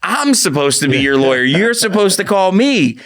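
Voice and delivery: rising, exasperated self-talk; exasperated inner rant. Retold or imitated. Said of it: "And I'm thinking".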